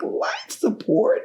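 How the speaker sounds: stunned disbelief. Retold or imitated. Mother asking the neurosurgeon about life support, in stunned high disbelief. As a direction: Life support, what do you mean, asked in disbelief.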